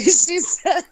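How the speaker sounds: high-pitched helpless laughter through words; too tickled to get the words out; cracking up. Real, not acted. Friend starts relaying comedian's video to the group, cracking up.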